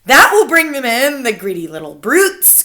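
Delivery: lightly gloating witch chorus; cried out. A narrator's reading. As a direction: gloat over the children. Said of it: "cried the audience".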